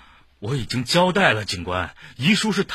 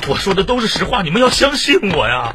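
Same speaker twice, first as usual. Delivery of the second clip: raised, urgent plea; desperate pleading; 4 semitones higher, 4 dB louder than usual. Acted. Suspect begging interrogators to believe him, pleading urgently. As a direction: beg to be believed, voice climbing.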